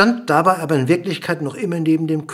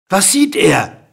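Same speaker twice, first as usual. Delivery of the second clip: raised, loud question; calling out; 5 semitones higher, 5 dB louder than usual. A narrator's reading. King quizzing the dwarf below, calling out. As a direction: call the question out. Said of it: "rief der König".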